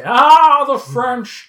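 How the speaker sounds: a loud, high, hammed-up Ah, then a droll the French; mock exasperation. Host joking about his French coworker: hammed-up exasperated sigh. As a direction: playfully sigh at the French, over the top.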